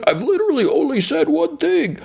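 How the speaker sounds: a mild, reasonable Bane done way up high; silly high-pitched imitation of Bane. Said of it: "He's like".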